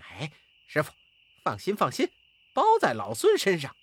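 cocky, unbothered reassurance from a monkey; carefree. Acted. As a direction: brush off the worry, quick at the end. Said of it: "满不在乎地说".

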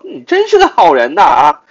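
loud, high mock compliment; mock them with exaggerated praise; sarcastic. Shown as a demonstration. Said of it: "所以我讽刺他说". (夸张的讽刺语气)

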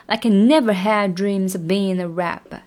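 never hit hard in the line; stress on never. Shown as a demonstration. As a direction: punch never, keep the rest light. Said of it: "这一段就是never". (重读never)

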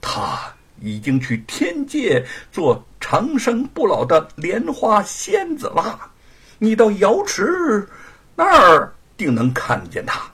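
slow, high nervous voice; timid trembling reply. Acted. King of Hell answering Monkey King timidly. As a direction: tread carefully, afraid to anger him. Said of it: "战战兢兢地说".

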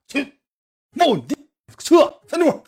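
high, loud shooing order; acted scolding. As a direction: send the kid away with a sharp yell. Acting himself ordering little brother away. (高声把老弟轰走)

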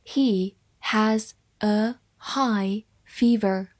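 very slow, each word separated; slow read-along. Shown as a demonstration. Teacher models the fever sentence for students, slow and spaced out. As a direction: say it word by word for learners to follow. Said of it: "慢慢来读".

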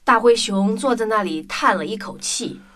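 ordinary delivery

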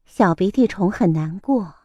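ordinary delivery, nothing marked

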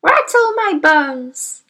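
slow, high, spooky parrot voice; mock mysterious. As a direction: drag it out like a ghost parrot. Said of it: "giving a wonderful imitation of a spooky voice".

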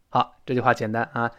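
usual speaking voice

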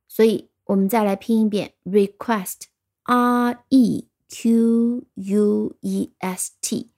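慢慢拼出R E Q U E S T; 放慢，逐个字母拼request; slow spelling. Shown as a demonstration. (再慢慢拼一遍单词)

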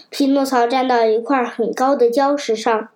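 ordinary delivery

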